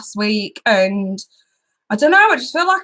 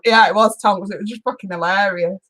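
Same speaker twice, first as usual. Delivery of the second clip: usual speaking voice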